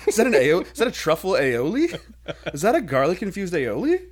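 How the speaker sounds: high, loud, laughing fancy-food gushing; mock-impressed diner. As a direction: gush over the menu with exaggerated awe. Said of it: "if you're like".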